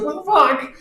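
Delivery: high, loud disbelief; high-pitched baffled exclamation; acted bewildered outburst. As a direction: cry out in shocked disbelief. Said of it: "so I'm just like".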